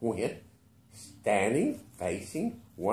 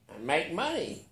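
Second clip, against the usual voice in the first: curt; 7 semitones higher than usual. A narrator's reading. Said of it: "he answered shortly".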